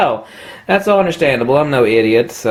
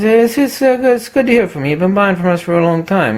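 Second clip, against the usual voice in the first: old salesman impression; 7 semitones higher than usual. Old clerk back on the phone with the lens, lightly voiced. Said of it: "he says".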